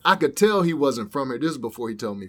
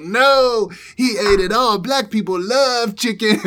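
very high, loud excited imitation; imitating the guy; 11 semitones higher, 8 dB louder, slower than usual. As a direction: shout it out like the delighted young guy. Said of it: "He's like".

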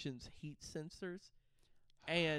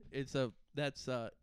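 ordinary talk, nothing marked

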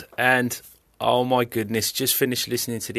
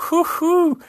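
cheering; 9 semitones higher, 8 dB louder than usual. Real, not acted.

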